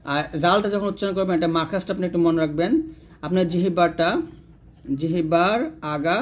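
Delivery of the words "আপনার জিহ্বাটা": usual speaking voice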